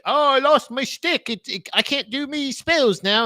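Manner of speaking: high, slow whining British wizard; mock wizard. Wizard who lost a staff, mock whine. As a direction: moan it slowly in a put-on British voice. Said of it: "wizard goes like".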